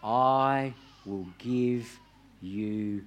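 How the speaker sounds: slow, hushed, fading to near silence on rest; tender scripture voice. Shown as a demonstration. Preacher voices Jesus to weary listeners, slow and hushed.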